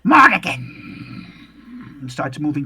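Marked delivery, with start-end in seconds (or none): 0.0-0.6 loud croaking creature call of a name; screeching were-raven cry. Acted. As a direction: shout it like a monstrous raven. GM voices raven calling name.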